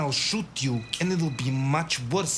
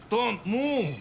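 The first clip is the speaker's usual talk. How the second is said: higher, sharp command; sharp order; 7 semitones higher than usual. Acted. Gunman to the hero reaching, sharp high command. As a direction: stop him cold.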